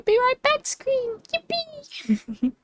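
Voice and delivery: very high, squeaky little cheer; giddy high-pitched excitement; excited squeal. Real, not acted. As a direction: show off something new in a tiny high squeal. Cheers for her new break screen.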